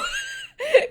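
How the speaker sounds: a high shriek of laughter; laughing shriek, very high; squealing laugh. Real, not acted. Laughs after protest.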